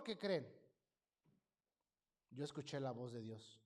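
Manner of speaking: ordinary delivery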